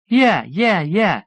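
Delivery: giggly high yeahs; giddy girl egging him on; tittering. A narrator's reading. College girl tittering at her date's joke. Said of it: "tittering and rocking back and forth".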